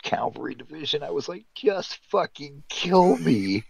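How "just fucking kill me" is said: high, loud, drawn-out comic plea; exaggerated exasperated groaning plea; mock despair. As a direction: stretch it out in hammed-up misery. Retelling own despair at the posting.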